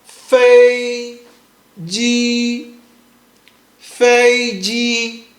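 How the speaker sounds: FEI JI, long level syllables; first tone. Shown as a demonstration. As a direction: hold fei and ji flat on one pitch. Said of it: "make sure it's flat".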